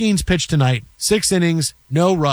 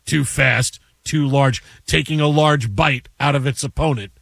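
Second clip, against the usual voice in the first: lower, steady sportscaster call of a hippo fight; deadpan mock broadcast; mock play-by-play; 4 semitones lower than usual. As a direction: call the fight like a live game.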